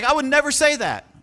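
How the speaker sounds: high, loud mock protest; indignant exclaim. Coach imitating the agent's shocked refusal, high and loud. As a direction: bristle at the very idea. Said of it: "but she's like".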